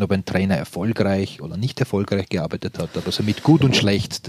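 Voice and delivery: ordinary talk, nothing marked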